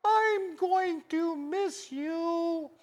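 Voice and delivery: high, very slow, crying goodbye; tearful little girl. Retold or imitated. Little daughter bids farewell at the grave, high, slow and tearful. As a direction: say goodbye through tears.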